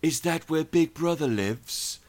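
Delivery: mock villain voice. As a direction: imitate the henchman mockingly. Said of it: "And then they go".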